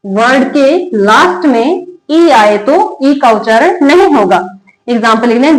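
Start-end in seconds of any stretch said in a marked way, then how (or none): 0.1-4.4 slow, deliberate rule read-out. Shown as a demonstration. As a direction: spell the rule out slowly for the class. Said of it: "क्या लिखा हुआ है?". (धीरे-धीरे नियम पढ़ना)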